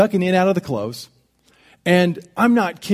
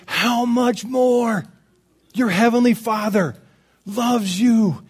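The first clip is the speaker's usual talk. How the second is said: slow, drawn-out, raised-pitch proclamation; emphatic preaching; 6 semitones higher, much slower than usual. Acted. Preacher tells congregation of Father's love, slowly and emphatically. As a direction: stretch each phrase out, weighty and high.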